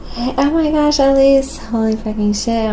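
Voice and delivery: high, loud, delighted hello; light up at a friend's arrival; excited greeting. Real, not acted. Streamer greeting a friend who joined, excited.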